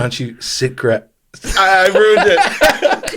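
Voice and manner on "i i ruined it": slow, high laughing admission; cracking up mid-line. Real, not acted. Flubbing the intro line, laughing.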